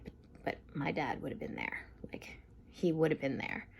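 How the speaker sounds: hushed, almost whispered plea; hushed quote. Retold or imitated. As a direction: breathe it out like a hurt son. Kevin's quiet tearful protest retold.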